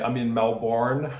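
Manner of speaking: Melbourne said the American way, "Mel-born" with a full r; slow, mock American "Melbourne"; American accent. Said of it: "Mel-, as some of the Americans say".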